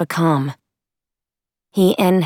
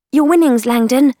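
high, louder character voice; rake's voice; 9 semitones higher, 6 dB louder than usual. A narrator's reading. Rake hands Tom his winnings, high loud character voice. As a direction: call it out high to hand over the prize.

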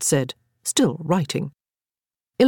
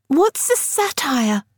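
put-on higher, slow, curious child; little girl voice; 10 semitones higher, slower than usual. A narrator's reading. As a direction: ask it like a wide-eyed girl. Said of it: "Frances cut in".

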